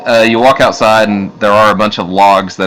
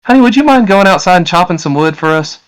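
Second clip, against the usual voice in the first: high-pitched wifely request; woman's voice; 12 semitones higher than usual. Acted. Woman asking her supposed husband for a chore, high coaxing voice. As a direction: ask a favour in a high woman's voice. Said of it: "she looks at you and says".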